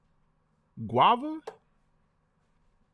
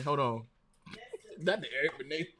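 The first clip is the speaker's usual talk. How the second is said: usual speaking voice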